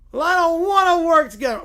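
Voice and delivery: loud, high petulant refusal; whiny Little Bear. Acted. Little bear refusing to work together, loud whine. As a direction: dig in like a bawling cub.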